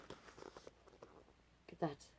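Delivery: slow, breathy whisper, almost silent; hushed whisper. Real, not acted. Pointing at the picture for the child, whispered. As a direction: draw them in with a whisper.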